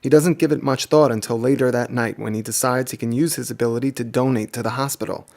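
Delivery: ordinary delivery, nothing marked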